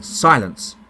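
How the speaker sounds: usual speaking voice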